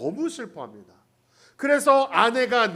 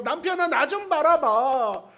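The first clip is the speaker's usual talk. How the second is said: slow, higher, louder plea for attention; Leah's aching plea; 6 semitones higher, 5 dB louder, slower than usual. Retold or imitated. Leah pleading with Jacob to notice her, slow and aching. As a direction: beg your husband to look at you, drawn out. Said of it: "하는 레아의 아픈 마음이 있어요".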